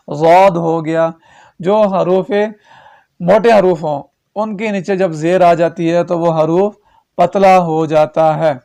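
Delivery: ordinary delivery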